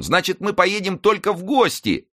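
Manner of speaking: high-pitched voice of a relieved servant. A narrator's reading. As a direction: exclaim high, as the worry lifts. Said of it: "с облегчением вздыхая, сказал Мушкетон". (облегчённо, высоким голосом слуги)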